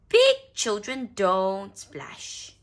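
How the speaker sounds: lightly voiced child, loud and slow; Peppa correcting. A narrator's reading. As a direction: state it slowly like a know-all kid. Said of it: "adds Peppa".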